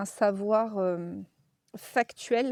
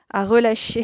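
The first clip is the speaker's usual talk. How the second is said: "relâcher" thrown out much louder in a hammed-up Lorraine accent; 10 dB louder than usual. Shown as a demonstration. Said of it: "excusez-moi mon accent lorrain". ("à relâcher" lancé fort avec l'accent lorrain exagéré)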